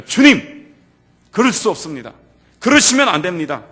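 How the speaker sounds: Peter blurting out a loud objection; a loud, pleading no from Peter; loud protest. Acted. Peter protests Jesus's cross.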